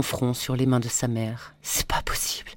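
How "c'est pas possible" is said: much softer whispered "C'est pas possible"; "C'est pas possible" whispered low and soft. A narrator's reading. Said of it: "répéta-t-elle".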